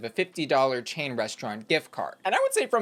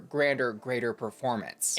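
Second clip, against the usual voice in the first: ordinary talk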